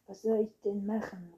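loud, outraged question; affronted outburst; indignant. A narrator's reading. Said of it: "rief Anton empört".

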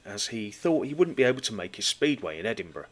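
ordinary talk, nothing marked

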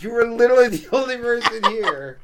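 loud, high incredulous uncle; exasperated shout, half laughing; mock exasperation. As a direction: imitate an uncle calling out an obvious lie. Retelling uncle catching the lie.

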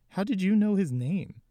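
high, loud confused question; bewildered. Acted. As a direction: ask it truly baffled. Carson bewildered.

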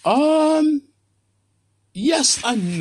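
drawn-out hesitation. Real, not acted. Long high drawn-out um.

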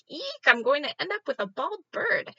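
high, loud panicked shriek of the owner; shrieking Rosa. Retold or imitated. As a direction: shriek it like a startled teacher. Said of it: "She was like".

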